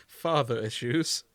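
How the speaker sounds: ordinary talk, nothing marked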